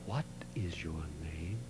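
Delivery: unvoiced, hushed question; whisper. Whispered third asking.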